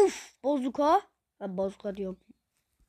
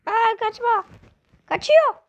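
excited squeal; 10 semitones higher, 6 dB louder, slower than usual. Real, not acted. Chasing a fleeing target in game.

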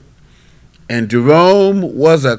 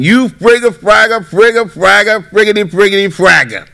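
mock cussing; 6 semitones higher than usual. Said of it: "and start cussing".

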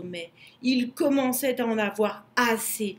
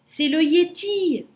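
higher, louder burst of recognition; excited exclamation; 7 semitones higher, 6 dB louder than usual. A narrator's reading. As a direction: exclaim it, pitched up and loud. Lou exclaims on hearing of spirit.

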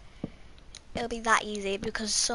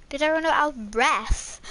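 ordinary talk, nothing marked

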